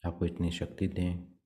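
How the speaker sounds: soft, even meditation voice with long pauses; guided meditation. Acted. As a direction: guide them gently into prayer, leaving long silences. Leads meditation and prayer. (शांत, धीमे स्वर में ध्यान का निर्देशन)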